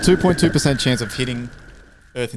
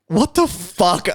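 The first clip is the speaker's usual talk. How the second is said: high, drawn-out disbelief; wigged out; 6 semitones higher, much slower than usual. Retold or imitated. Man retelling his freak-out in the shop, wigged out. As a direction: re-enact being freaked out, slow and high. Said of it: "fucking wigged myself out".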